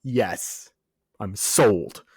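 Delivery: ordinary talk, nothing marked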